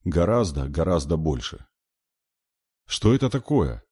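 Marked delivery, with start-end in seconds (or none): 2.9-3.8 high-pitched, repelled question; mild disgust, raised pitch. A narrator's reading. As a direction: ask it in distaste. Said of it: "с отвращением проговорила Алла".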